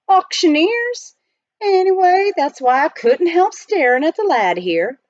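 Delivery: high, loud, folksy prospector; old miner voice. Acted. Miner explaining to the butler, folksy and loud. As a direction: play the chatty gold miner.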